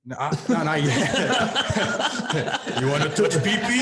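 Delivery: high-pitched, laughing through the words. Retold or imitated. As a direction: giggle through the quote. Laughing while quoting the exchange.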